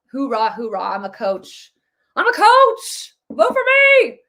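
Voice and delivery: a campaign cheerleader whipping up the crowd; mock rally coach. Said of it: "Waltz was just your typical".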